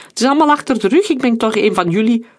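fast, high, loud pleading; urgent protest. A narrator's reading. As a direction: protest your loyalty in a rush. Scout pleads at gunpoint.

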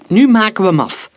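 high, loud, firm order; admonishing. A narrator's reading. Soldier ordering his comrade to finish the job, admonishing. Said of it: "sprak Udo hem vermanend toe".

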